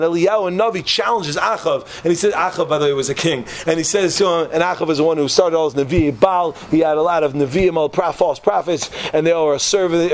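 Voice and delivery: normal talking voice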